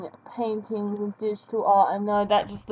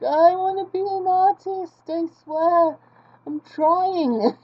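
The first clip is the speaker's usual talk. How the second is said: high, hammed-up wail; mock crying; 7 semitones higher, 4 dB louder than usual. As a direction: plead like a failed artist pretending to cry. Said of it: "I'm crying in the corner".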